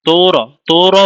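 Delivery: slow তোর broken into তো-র, vowel sounded after র; split in two. Shown as a demonstration. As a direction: drag তোর out as two syllables. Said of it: "আমরা কি আলাদা আলাদা করি".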